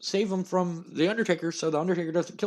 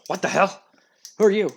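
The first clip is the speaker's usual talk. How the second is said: startled, louder and higher outburst; angry retort; 4 semitones higher, 7 dB louder, faster than usual. Retold or imitated. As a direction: snap at the intruder. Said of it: "Vince McMahon goes".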